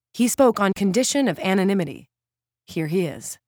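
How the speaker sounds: live on-location report; dramatic reporter delivery; TV field reporter. Acted.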